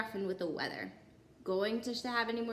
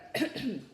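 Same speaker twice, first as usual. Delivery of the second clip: awkward little throat clear; nervous throat clear. Acted. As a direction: clear the throat before speaking. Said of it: "He cleared his throat".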